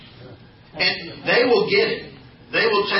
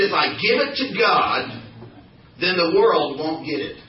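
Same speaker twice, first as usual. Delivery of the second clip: repeated louder for the crowd. Shown as a demonstration. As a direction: say it again, louder, so they answer. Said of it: "I beg your pardon?".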